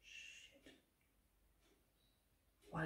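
a voiceless curse under her breath; whispered curse. Real, not acted. After slipping with her makeup, a whispered curse. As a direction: breathe the swear out almost silently. Mistake while applying makeup.

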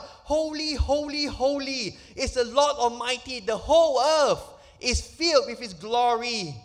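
a preacher voicing the angels' loud cry; angels crying out. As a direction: call it out like angels praising. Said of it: "with the angels crying out".

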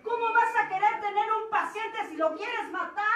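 loud, harsh self-reproach; self-scolding rant. Retold or imitated. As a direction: tear into yourself, loud. Se regaña a sí misma. (regañándose a sí misma con furia)

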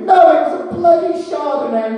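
put-on high, loud little-kid correction; a toddler firmly setting the grown-up straight, pitched high; deadpan toddler reply. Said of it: "looked us square in the eyes and went".

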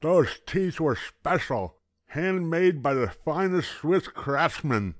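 put-on high, slow, loud gummy old man; distressed toothless grandpa. A narrator's reading. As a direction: mumble it like a grandpa without teeth.

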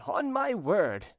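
high, slow, savoured exclamation; gloating schemer. A narrator's reading. Cousin to himself as he leaves, gloating. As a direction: savour it as a private oath.

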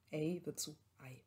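first Ei with half-open E start, second Ei with modern A start; Ei versus Ai. Shown as a demonstration. Lecturer contrasts old and modern Ei for students. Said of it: "halboffenen E ... anstatt das A".

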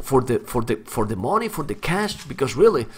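normal talking voice

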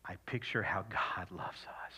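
whisper. Real, not acted. Tender moment holding grandchild.

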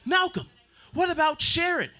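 high, slow pleading voice; Facebook pleading. Retold or imitated. Facebook begging the comedian not to leave, high pleading voice. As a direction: beg like a clingy app. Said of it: "Facebook said".

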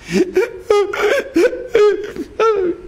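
high hiccuping fake sobs; mock sobbing. Little girl crying over the chicken, mock sobbing. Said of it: "שהיא בוכה".

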